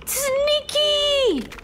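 sneaky squeaked out very high; a gleeful squeaky cry of sneaky; high sneaky squeal. Pretend.

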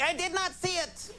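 high-pitched mock protest; mock indignant viewer. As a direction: put on a high voice for the clueless viewer. Mimics people who missed the game.

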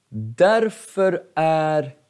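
usual speaking voice

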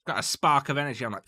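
ordinary talk

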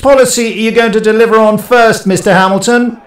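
high, loud shouted question; shout from a crowd. Acted. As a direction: call it out over a press pack. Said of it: "A voice from the crowd could wait no longer".

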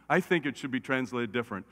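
usual speaking voice

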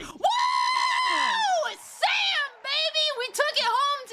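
real joy at a right answer; excited whoop. Real, not acted. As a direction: cheer like you just won big. Wins the question, celebrates.

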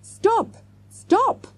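very high, contained shout. A narrator's reading. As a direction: call after them in a high voice. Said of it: "the man shouted".

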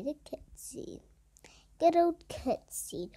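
hushed, slow whisper. Real, not acted. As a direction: whisper it under your breath. Whispering during the cutscene.

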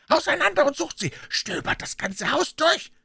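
very high, strained shout; repeated command. A narrator's reading. Puh repeating his order, shouted command. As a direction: drive the searchers on. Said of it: "wiederholte Puh".

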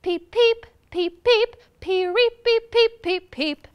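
high, loud chick peeps; piercing peeps at high pitch; yelling. A narrator's reading. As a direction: yell it like a frantic chick.

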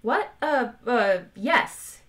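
halting, bewildered yes; baffled stammer. A narrator's reading. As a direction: answer as if caught off guard. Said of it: "Arthur blinked".

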